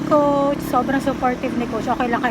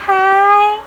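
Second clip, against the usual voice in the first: high, loud doting squeal; excited high-pitched mama-to-puppy voice; 8 semitones higher, 7 dB louder, slower than usual. Real, not acted. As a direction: greet the dog in a high, drawn-out singsong. Greeting pet in baby talk.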